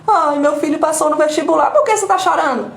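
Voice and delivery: mock the person who always finds a reason to suffer; hammed-up fretful sigh of a perpetual victim; whiny self-pitying lament. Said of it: "é aquela pessoa que".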